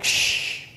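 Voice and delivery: sharp voiceless shush; crowd hushing. Acted. Crowd silencing the blind man, acted shush. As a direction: shush him like an annoyed crowd. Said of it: "everyone is like".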